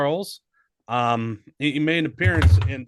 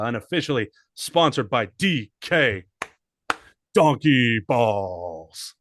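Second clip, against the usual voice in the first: ad announcer. Acted. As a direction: sell it like a radio ad. Mock sponsor read again.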